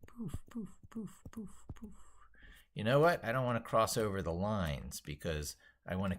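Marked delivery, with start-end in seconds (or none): 0.1-1.9 high, breathy whispered poof sounds like a spell; playful whispered sparkle noises; whispered magic poofs. Pretend. Drawing a magic hat, sound effect.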